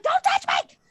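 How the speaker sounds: very high, loud, hysterical pleading; swooning panic act. Acted. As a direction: play the frantic mother-to-be to the hilt. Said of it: "swooning in a completely believable way".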